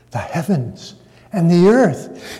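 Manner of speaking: "heavens" and "earth" each set apart, slowly; slow down and weight "heavens", then "earth"; stress on HEAVENS and EARTH. Shown as a demonstration. Preacher emphasizing the ending part, "heavens" and "earth" drawn out. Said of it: "emphasize the ending part of the verse".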